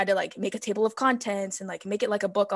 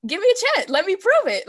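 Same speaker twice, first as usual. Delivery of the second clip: much higher, louder pleading; 10 semitones higher, 3 dB louder than usual. Retold or imitated. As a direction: beg them like your future depends on it. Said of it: "And I'm like".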